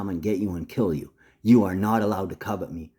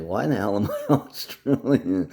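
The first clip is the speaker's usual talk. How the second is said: laughing, puzzled Aussie outburst; Aussie accent; 3 semitones higher, 4 dB louder than usual. Retold or imitated. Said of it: "Australian accent. I was like".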